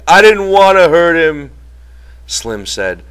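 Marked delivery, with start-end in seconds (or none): 0.1-1.5 high, louder wailing protest; distressed cry. A narrator's reading. Lenny protests he meant no harm, distressed loud cry. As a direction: wail it out, desperate to be believed. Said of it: "Lenny cried".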